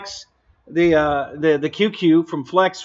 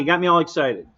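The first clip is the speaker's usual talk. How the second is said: normal talking voice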